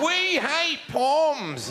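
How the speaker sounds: high, slow, drawn-out jeer; hammed-up slogan; mock banner slogan. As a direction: chant it like a taunt.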